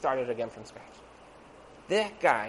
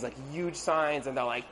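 ordinary talk

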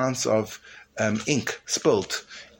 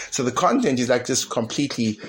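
normal talking voice

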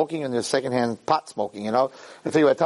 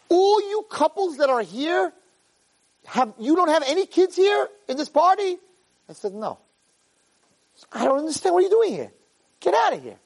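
incredulous policeman. Retold or imitated. Rabbi mimicking the baffled policeman, high pitched disbelief. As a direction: play the cop who can't believe it, way up high.

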